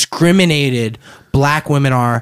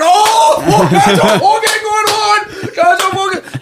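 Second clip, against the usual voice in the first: high exclaiming Newfie voice; Newfoundland accent. Retold or imitated. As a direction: squeal it like a shocked Newfoundlander. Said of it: "your two Newfies are going".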